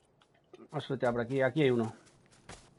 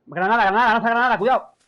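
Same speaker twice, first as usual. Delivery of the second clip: urgent shouted grenade warning; grenade warning yell; 8 semitones higher, 9 dB louder than usual. Real, not acted.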